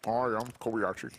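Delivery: talking through a mouthful; words round a bite of food; mouth full. Pretend. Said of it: "Got my mouth full".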